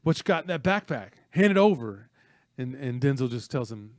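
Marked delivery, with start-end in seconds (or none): 0.0-2.1 raised, higher voice of a bully; loud retold smack talk; thug's demand. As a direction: demand it loudly, like a thug starting a fight. Acting out thug demanding backpack.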